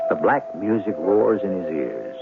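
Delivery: usual speaking voice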